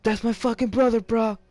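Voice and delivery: high, loud protective shout; angry yell. Retold or imitated. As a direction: shout it to defend a brother. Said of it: "And he goes like".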